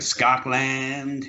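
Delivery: slow American "Scotland" with an open "-land"; American accent. Retold or imitated. Teacher imitates how Americans say "Scotland", in an American accent. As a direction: drawl "Scotland" the American way, as a tease. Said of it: "They say, like".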